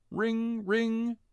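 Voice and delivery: high sing-song ring ring; phone ring imitation. A narrator's reading. Narrator imitating a cell phone, high chime.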